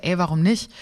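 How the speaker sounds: usual speaking voice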